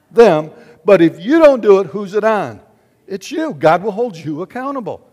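loud, driving pulpit voice; fire-and-brimstone preaching; forceful preaching. Real, not acted. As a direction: press the point hard on the congregation.

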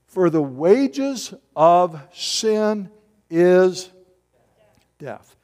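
drawn-out, very slow reading of the verse; solemn slow scripture quote. Shown as a demonstration. Reading Romans 6:23 aloud.

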